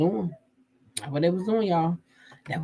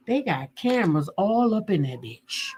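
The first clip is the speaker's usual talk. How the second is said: ordinary delivery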